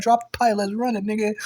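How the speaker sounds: high-pitched, confrontational dare; fired-up challenge. Retold or imitated. Speaker daring the man holding a pipe, fired-up. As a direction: act out goading him into a fight.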